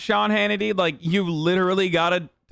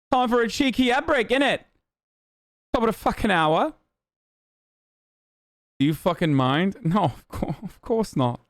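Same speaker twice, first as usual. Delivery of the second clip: cheeky mock British chav patter; put-on British accent; 4 semitones higher than usual. Streamer introducing cheeky ad break, mock British accent. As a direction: sell it in a hammed-up British accent. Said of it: "every fucking accent that I know how to do".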